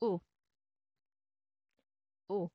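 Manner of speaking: "U" as a short vowel like a double O; French U sound. Shown as a demonstration. Teacher repeating the sound for learners, French vowel. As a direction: model "U" alone, briefly. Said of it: "tunog ni letter U in French".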